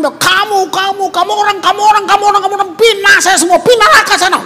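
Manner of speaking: high, loud hammed-up tirade; mock angry scolding. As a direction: rattle off accusations like a raging preacher. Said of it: "Sedap kalau marah-marah orang". (pura-pura marah-marah, nada tinggi)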